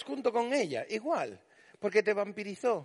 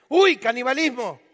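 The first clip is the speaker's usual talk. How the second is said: escándalo burlón; 5 semitones higher, 9 dB louder than usual. Retold or imitated. Usuarios de redes escandalizados.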